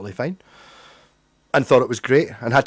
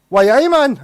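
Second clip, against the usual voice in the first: high, loud Geordie accent; Newcastle accent; 9 semitones higher, 6 dB louder than usual. Shown as a demonstration. Joining in with Newcastle locals, mimicked Geordie accent. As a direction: burst into a put-on Newcastle accent. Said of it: "I could do a Newcastle accent".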